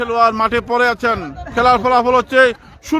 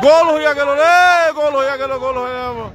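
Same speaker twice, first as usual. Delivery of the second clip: goal celebration call; 2 semitones higher than usual. Real, not acted.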